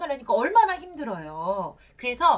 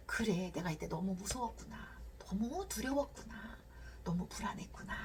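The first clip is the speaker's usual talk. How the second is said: soft self-comforting whisper; 12 dB quieter than usual. Shown as a demonstration. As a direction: read it back to yourself in a near whisper. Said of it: "그 글을 내가 한번 읽어보는 거예요".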